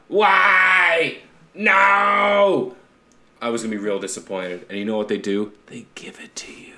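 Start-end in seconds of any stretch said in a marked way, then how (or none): 0.1-2.8 high, drawn-out mock shout; imitated yell. Host mimics the actor's signature outburst, drawn-out yell. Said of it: "If he doesn't yell and go". 5.7-6.7 whispered, voiceless aside; hushed whisper. Real, not acted. Host telling friends the show delivers, in a whisper.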